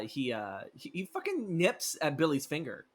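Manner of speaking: usual speaking voice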